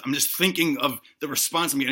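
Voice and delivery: normal talking voice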